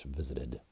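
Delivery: "visited" with the middle t said as a quick soft D flap, vi-zi-did; American flapped t. Shown as a demonstration. Teacher showing learners the American English sound, a gentle D in "visited". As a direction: say "visited" the American way, the t tapped like a light D. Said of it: "And I'm pronouncing this, um, in American English".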